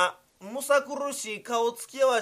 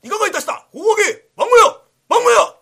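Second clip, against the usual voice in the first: much higher and louder, furious pounding shouts; enraged samurai; 12 semitones higher, 13 dB louder than usual. Acted. Angry samurai pounding the hut door, roaring. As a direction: roar at the door fit to break it. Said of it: "割れんばかりに叩き出した".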